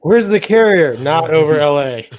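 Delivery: comic bellowed question and answer; mock shout. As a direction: belt it out as a gag. Mock exclamation as a joke.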